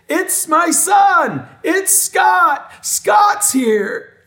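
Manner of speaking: high, drawn-out excited shouting; imitating an overjoyed dad's loud cry; jubilant announcement. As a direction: proudly announce your son to the whole room. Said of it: "And he announced me to everyone".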